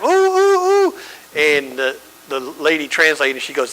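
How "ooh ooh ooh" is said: put-on high, squealing child's ooh; excited kid. A preacher imitating an orphan kid's delighted squeal. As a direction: squeal like a thrilled little kid.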